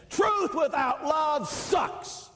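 high, slow, shouted retort. Retold or imitated. Priest shouting back at the caller, slow high retort. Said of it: "And then I sat there and says".

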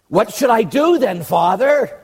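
pleading question. Retold or imitated. Husband asking priest what to do, mock pleading. Man asks priest for advice.

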